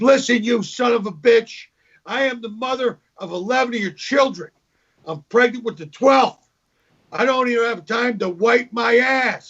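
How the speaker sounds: a mock-shrill wife tearing into her husband; angry wife. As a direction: snap back at him as the fed-up wife.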